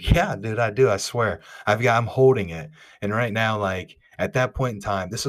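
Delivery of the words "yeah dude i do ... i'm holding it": excited insisting. Retold or imitated. Insisting he holds the fish.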